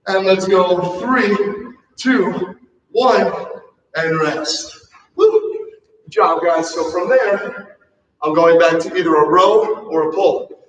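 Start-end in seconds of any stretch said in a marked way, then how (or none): 5.2-5.7 high happy whoop after the set; excited whoop. Real, not acted. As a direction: cheer the group on with a whoop. Cheer at end of set.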